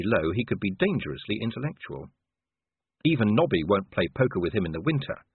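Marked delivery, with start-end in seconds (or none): none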